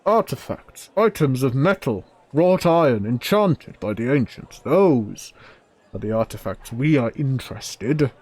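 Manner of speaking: loud, emphatic, high-swooping declamation; angry forge dweller. Acted. Forge dweller listing wanted metal artifacts, loud and emphatic. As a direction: proclaim each item with fierce pride. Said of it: "The voice comes back angry".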